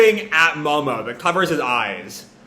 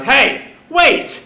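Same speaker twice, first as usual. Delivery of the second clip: high, raised call to stop; 8 semitones higher, 4 dB louder than usual. Retold or imitated. Voice hailing the departing Straw Hats, calling out. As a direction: shout after someone departing. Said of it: "calling out".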